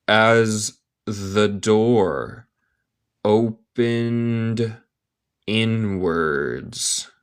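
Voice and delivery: very slow, word by word with pauses; slow careful reading. Shown as a demonstration. English teacher reading a story to learners, word by word. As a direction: stretch each word out for learners.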